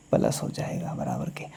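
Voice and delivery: breathy whisper of the answer. Shown as a demonstration. Teacher whispering the answer to the class. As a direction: drop to a whisper to give the answer.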